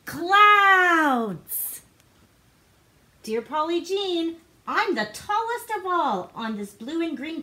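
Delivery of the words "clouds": high, loud delighted announcement; excited reveal. Acted. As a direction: announce it like a wonderful surprise. Reveal of cloud pajamas.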